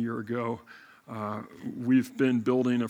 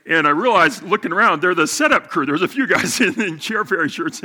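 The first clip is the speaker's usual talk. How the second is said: laughing; 6 semitones higher, 12 dB louder than usual. Real, not acted. Amused recalling the setup crew.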